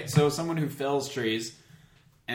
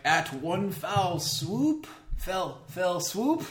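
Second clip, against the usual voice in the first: lightly theatrical Shakespeare quote, higher and slow; mock Shakespeare line; 7 semitones higher, much slower than usual. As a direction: recite it like a hint of a stage actor. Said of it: "and then he or she is like".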